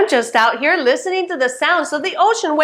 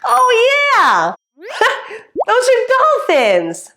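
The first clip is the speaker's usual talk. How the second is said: high, delighted exclamation with a laugh; excited discovery; 9 semitones higher than usual. Acted. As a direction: burst out as if you just recognized it.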